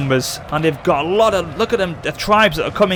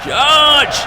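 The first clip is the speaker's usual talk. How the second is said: high shouted charge order; rally them to charge; battle cry; 7 semitones higher than usual. Acted.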